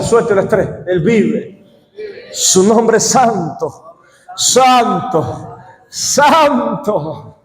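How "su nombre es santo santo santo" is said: slow, drawn-out praise; solemn slow chant of santo. Real, not acted. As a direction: stretch each santo out slowly. Preacher drawing out holy.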